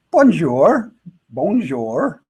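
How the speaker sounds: cheery bonjour, bonjour with a rising French lilt; friendly bonjour. Retold or imitated. Greeting French shopkeepers warmly, bonjour with a rising lilt. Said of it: "just saying".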